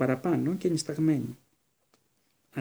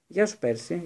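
ordinary delivery, nothing marked

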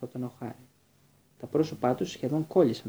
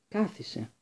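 one high, cold word; a stern teacher ordering a pupil to sit; curt order; 8 semitones higher, slower than usual. A narrator's reading. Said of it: "είπε ο Σνέιπ".

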